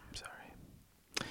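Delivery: barely audible slow whisper; whispered apology. Real, not acted. Speaker apologizing after judging people who can't cook, whispered. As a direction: whisper it, drawn out and tiny.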